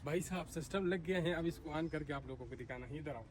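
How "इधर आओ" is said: ordinary talk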